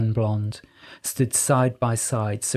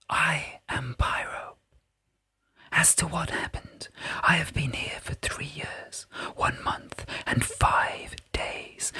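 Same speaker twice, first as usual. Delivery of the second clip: a softer, unseen spirit's whisper; whispering Pyrope; 3 semitones higher, 7 dB quieter than usual. A narrator's reading. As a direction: breathe each word like a hidden voice.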